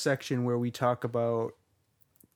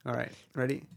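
ordinary talk, nothing marked

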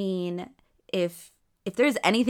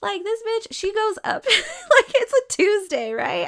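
high, louder, laughing as she tells it; giggly excitement; 12 semitones higher, 7 dB louder than usual. Real, not acted. Telling listeners about her friend at the bar, laughing excitedly. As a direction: bubble over, laughing through the story.

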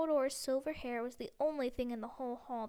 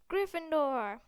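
loud called-out house name; hat's shout; 3 semitones higher, 6 dB louder, slower than usual. A narrator's reading. Sorting hat shouting to the new student, loud. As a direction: announce it loud to the whole hall. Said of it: "the hat had shouted".

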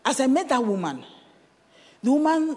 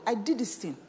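ordinary talk, nothing marked

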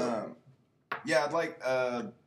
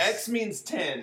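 slow, higher mock teacher voice; 8 semitones higher, much slower than usual. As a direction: spell it out like a cheesy teacher.

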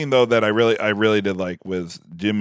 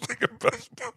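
higher, slow, breathy giggle through the words; whispered laugh; 13 semitones higher, 6 dB quieter, slower than usual. Real, not acted. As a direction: echo the line while laughing. Laughing repeat of partner's slip.

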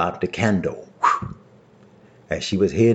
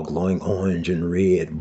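usual speaking voice

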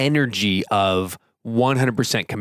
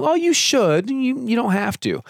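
much higher, light and loose; permissive shrug; 12 semitones higher than usual. Shown as a demonstration. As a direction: offer it as if it really doesn't matter. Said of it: "they're not saying".

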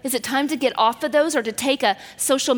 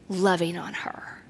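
breathy whisper with no voice; a hushed, tender whisper; 5 semitones lower, 6 dB quieter than usual. A narrator's reading. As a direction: whisper it tenderly, as if to the girl beside you. Tender moment with the girl.

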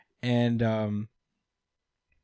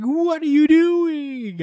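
very high, loud, drawn-out outraged shriek; hammed-up tsundere scolding; 6 dB louder, much slower than usual. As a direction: screech it like an embarrassed anime girl. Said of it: "[name] goes into full, uh, tsundere mode".

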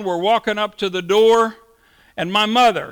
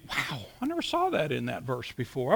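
softly awed, voice dropping away; hushed amazement; 6 semitones lower, 10 dB quieter than usual. Acted. Reader suddenly noticing something new in a familiar verse, hushed amazement. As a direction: wonder at it under your breath.

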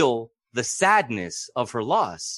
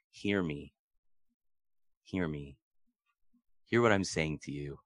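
soft plea; 9 semitones lower, 9 dB quieter than usual. Retold or imitated. Intimate plea voiced.

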